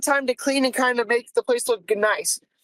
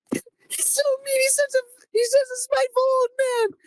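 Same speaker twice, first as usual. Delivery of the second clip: high, wailing imitation of her sobbing; mock crying; 12 semitones higher than usual. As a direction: whine it out like a coworker in tears. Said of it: "She's crying".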